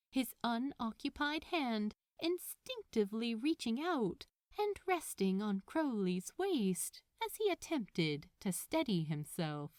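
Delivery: ordinary delivery, nothing marked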